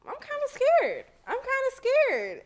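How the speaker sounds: high, loud comic panic; mock scared. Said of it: "my heart started beating a little bit".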